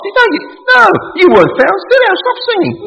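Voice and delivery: hammed-up stern usher telling someone off; preacher pretends to shout down a hymn singer, mock scolding. Mock-scolds a nonbeliever singing.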